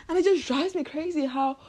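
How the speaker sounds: usual speaking voice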